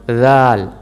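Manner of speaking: राल held slow and long with a rolled r; drawn-out ral. Shown as a demonstration. Tajweed teacher slowly demonstrating राल for learners. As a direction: stretch राल slowly, tongue in place. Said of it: "जब आप ज़ुबान वहाँ पे रखेंगे".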